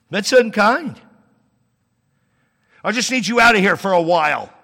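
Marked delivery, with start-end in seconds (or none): 2.8-4.5 impatient parent. Retold or imitated. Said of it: "That's harsh".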